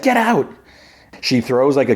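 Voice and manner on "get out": very high mock yell; mock scream. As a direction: act out a frightened woman ordering him out. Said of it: "she, she screams".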